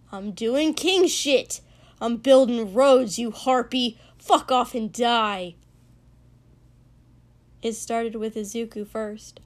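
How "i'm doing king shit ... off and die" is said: angry shout. A narrator's reading. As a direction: bark it back at the harpy. Screaming match continues.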